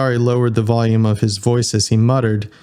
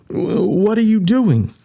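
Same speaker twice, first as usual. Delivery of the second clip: stammered question; 10 semitones higher than usual. Acted. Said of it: "Pendle asked".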